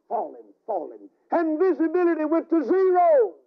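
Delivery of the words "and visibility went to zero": preaching climax. Acted.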